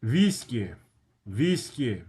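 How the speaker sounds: "Whiskey" as Russian VEES-kee, with a v; "Whiskey" said the Russian way, VEES-kyeh; Russian pronunciation. Shown as a demonstration. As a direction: open "Whiskey" with a v sound and long ee. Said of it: "whiskey is whiskey".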